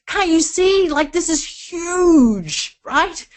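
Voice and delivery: mock excited pleading. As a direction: sell her on how huge it is. Pleading excitement with reluctant wife.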